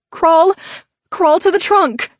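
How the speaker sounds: high, loud shouted order; shouting. A narrator's reading. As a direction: yell it over the fire. Screaming orders in the fire.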